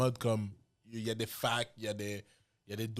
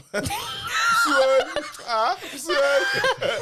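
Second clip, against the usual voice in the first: shouting through big laughter; high, loud laughing outburst; 14 dB louder, much slower than usual. Real, not acted. Cracking up at friend's joke.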